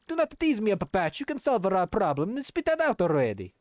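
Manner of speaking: put-on high, impatient Italian accent. Flavio presses Patch for the solution, high Italian voice. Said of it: "the kind of Italian voice".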